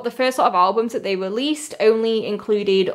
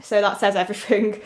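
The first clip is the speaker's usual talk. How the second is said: ordinary talk, nothing marked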